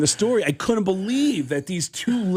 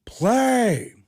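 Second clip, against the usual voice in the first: louder, mocking Pacino sneer; Al Pacino impression; 4 semitones higher, 3 dB louder than usual. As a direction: sneer at his little play. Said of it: "And he goes".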